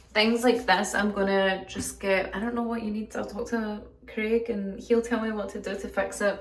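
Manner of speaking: ordinary talk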